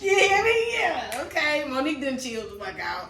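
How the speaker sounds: high, hollered self-congratulation; exuberant crowing. Real, not acted. Celebrating having calmed down, exuberant hollering. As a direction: holler it out proudly, pitch soaring.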